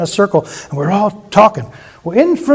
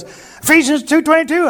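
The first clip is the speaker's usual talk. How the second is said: imitating Bob; 9 semitones higher than usual. Bob answers in story.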